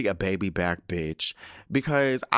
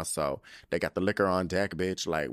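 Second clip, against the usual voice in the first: normal talking voice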